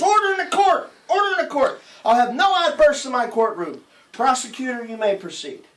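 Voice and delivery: high, loud judge bellowing for order; shouting judge. Acted. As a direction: bang the gavel with your voice. Judge pounding gavel in joke.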